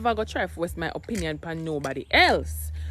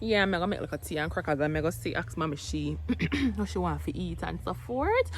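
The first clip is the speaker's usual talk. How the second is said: usual speaking voice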